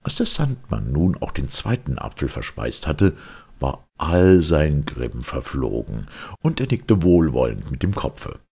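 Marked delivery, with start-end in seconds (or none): none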